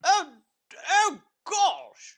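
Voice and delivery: loud, very high put-on prim voice; very proper uncle. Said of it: "Very proper".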